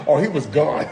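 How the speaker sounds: usual speaking voice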